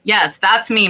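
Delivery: ordinary delivery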